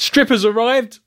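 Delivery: playful excited shout; mock party cry. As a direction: call it out like an eager party guest. Acting out the party women's cry.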